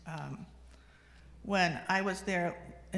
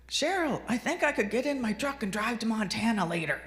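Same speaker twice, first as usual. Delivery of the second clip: put-on loud, raised voice of an old father; imitated dad; 4 semitones higher, 5 dB louder than usual. Dad announcing a plan to his wife, loudly. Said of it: "He said in the next sentence".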